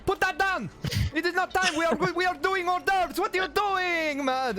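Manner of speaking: high, loud, bossy chef voice yelling orders; hammed-up frantic head chef barking at the help; shouting chef. Head chef yelling at the disguised newcomer, high and loud. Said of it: "He's like".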